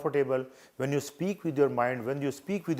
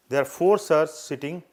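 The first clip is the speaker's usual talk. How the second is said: ordinary talk, nothing marked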